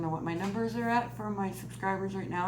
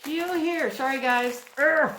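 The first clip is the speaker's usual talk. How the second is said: loud, higher burst of exasperation; frustrated outburst; 7 semitones higher, 9 dB louder than usual. Real, not acted. Vlogger fighting a package that won't open, frustrated. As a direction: lose patience with the stuck packaging.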